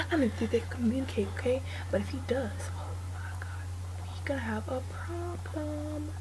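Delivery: hushed unvoiced whisper. Real, not acted. Hiding in a cell from the killer, whispering.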